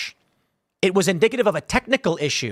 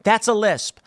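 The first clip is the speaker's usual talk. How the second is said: ordinary talk, nothing marked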